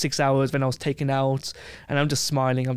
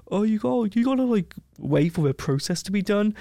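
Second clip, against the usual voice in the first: higher, whiny mock official voice; mocking embassy official; 7 semitones higher than usual. Traveller mocking the embassy's brush-off in a higher, whiny mock voice. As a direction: parody the useless bureaucrat, pitched up.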